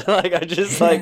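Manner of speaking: words broken up by real laughter; giggling while talking; laughing. Real, not acted. Laughing while retelling confusion.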